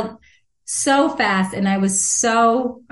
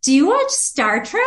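high, bubbly and eager; excited office voice; 8 semitones higher than usual. Retold or imitated. Adoption office worker thrilled to report the match, excited. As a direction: gush it like a thrilled receptionist. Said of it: "she was so excited".

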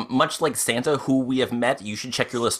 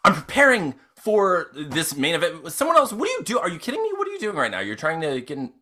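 rising high in mock outrage; indignant outburst; 5 semitones higher, faster than usual. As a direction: huff at the intruder. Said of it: "Arnold just turns and says".

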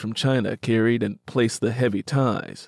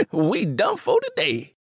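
put-on high, laughing young man's voice; laughing young cousin; 9 semitones higher than usual. Willie to Simi, quitting for the day, high laughing voice. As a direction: chuckle then say it lightly, pitched up. Willie cheerful after work.

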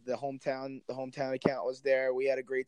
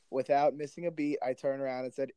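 normal talking voice

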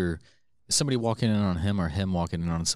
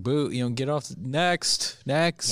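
higher mock booing; mock heckling crowd; 7 semitones higher, much slower than usual. Imitating crowd jeering a band, higher mock heckle. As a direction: call it up like a bored heckler.

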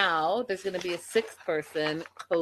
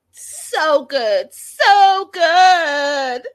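gushing; 9 semitones higher, 11 dB louder, much slower than usual. Retold or imitated. Gushing quote of Emily.

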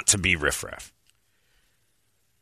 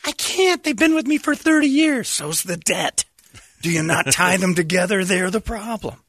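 high, put-on falsetto; high squeaky mock owner; 11 semitones higher than usual. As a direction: voice the owner in a silly high pitch. Voicing bar owner reply.

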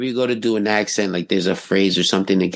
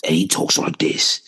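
lightly imitated movie tough guy, pitched down; Jason Statham; 7 semitones lower than usual. Shown as a demonstration. Showing how he talks, lightly as Jason Statham. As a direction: drop the voice for a light impression. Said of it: "it's like Jason Statham".

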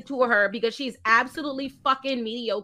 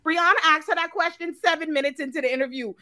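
ordinary talk

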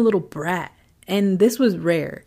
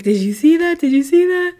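rising, louder, much higher the second time; frightened disbelief; 9 semitones higher, 5 dB louder than usual. Retold or imitated. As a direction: panic building on the repeat. Kids fleeing outside, shocked.

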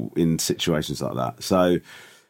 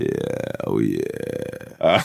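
low croaky oh yeah; sexy frog voice; 10 semitones lower, much slower than usual. Pretend. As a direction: purr it like a cartoon frog. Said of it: "sexy frog from a Disney cartoon".

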